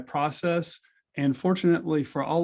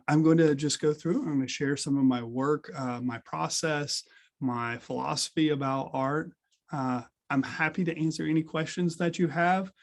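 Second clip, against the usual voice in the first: ordinary talk, nothing marked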